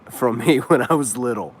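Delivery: normal talking voice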